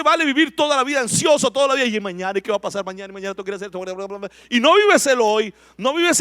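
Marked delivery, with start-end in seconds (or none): 1.8-4.3 a hint of a fretful worrier, much softer; anxious worrier. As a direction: mutter the worries of tomorrow quietly. (imitando a alguien ansioso, más suave)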